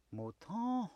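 soft, high falsetto call; highest voice. Acted. Wolf poses as the sheep's mama, highest voice. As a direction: coo the name high and softly. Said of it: "he called out in his highest voice".

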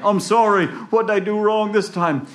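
high, loud, wailing plea; frantic apology. Acted. Jack begging the farmer hitting him, high frantic plea. Jack being hit, pleading.

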